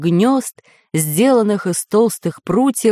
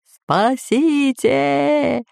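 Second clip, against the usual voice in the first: very slow drawn-out howl; bellowing; 7 semitones higher, much slower than usual. A narrator's reading. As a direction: wail for rescue. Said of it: "ревел Бульбуль".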